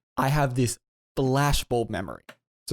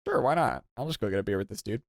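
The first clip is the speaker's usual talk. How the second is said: shrugging it off; 4 dB quieter, faster than usual. Retold or imitated. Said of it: "I'm just like".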